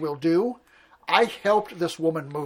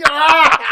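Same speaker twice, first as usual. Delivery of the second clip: laughing shout; 9 semitones higher, 13 dB louder than usual. Real, not acted. Laughing loud at old joke.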